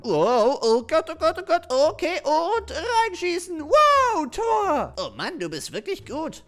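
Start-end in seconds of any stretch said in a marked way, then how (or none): 0.0-4.9 high, loud laughing whoops; excited yelling. Acted. [name] scoring a goal, loud excited cheering.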